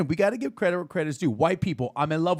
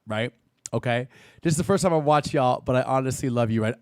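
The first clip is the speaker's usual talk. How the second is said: ordinary talk, nothing marked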